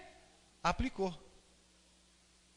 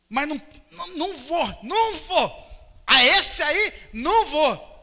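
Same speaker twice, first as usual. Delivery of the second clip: higher-pitched, mock stubborn refusing; stubborn refusal; 5 semitones higher than usual. Proud servant refusing to ask for help, mock stubborn. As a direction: act out digging your heels in.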